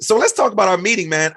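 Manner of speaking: can't hold back the enthusiasm; excited. Real, not acted. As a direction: voice jumps up high, eager to start. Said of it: "as my listeners can hear in my voice".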